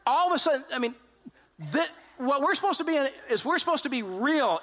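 ordinary talk

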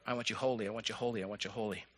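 low, soft repetition, fading away; hushed divine refrain. Acted. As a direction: quietly voice God's repeated wish. Voices God, repeated soft refrain.

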